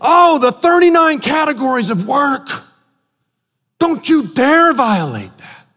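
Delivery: lampoon the rule-keepers; mock scolding. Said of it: "that are saying".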